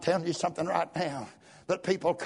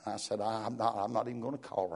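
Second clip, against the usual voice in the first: quiet; 6 semitones lower, 5 dB quieter than usual. Real, not acted. Quiet private recollection.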